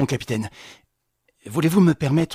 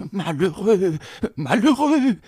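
affolé; 5 semitones higher than usual. Acted. Concierge affolé.

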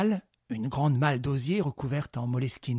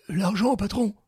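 henchman; 7 semitones higher, 5 dB louder than usual. Acted. Henchman asks boss for money.

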